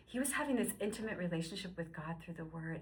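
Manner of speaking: normal talking voice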